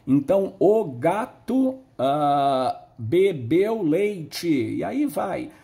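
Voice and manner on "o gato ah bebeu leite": slow, louder, word by word dictation; sounding out slowly; slow syllables. Shown as a demonstration. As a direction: dictate it to a child one piece at a time. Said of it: "uma letrinha para cada sílaba".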